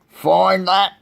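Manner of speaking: loud, high taunting eagle; sneering. A narrator's reading. Eagle to Horton after hiding the clover, sneering. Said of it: "sneered the bird".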